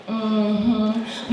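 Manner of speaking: lightly voiced mom's unimpressed hum; mother's mm-hmm. Mom humming back at her daughter's boasting, unimpressed. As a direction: hum it like a mother not really listening. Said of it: "in that mother voice".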